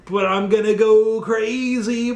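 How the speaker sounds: high, strange voice rising into a frenzy; mock crazed ninja, building up; unhinged cyborg ninja. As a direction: play a ninja about to snap. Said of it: "totally flips out".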